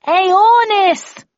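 very loud, very high yell; desperate full-force call; shouting. A narrator's reading. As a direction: bellow the name, stretched out. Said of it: "I shouted at the top of my voice".

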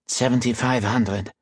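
a hint of a nasal French priest, bargaining; a touch of light French accent on the price; lightly voiced priest. A narrator's reading. Archivist names his price to a buyer, lightly French. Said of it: "Mauger's nasal tone".

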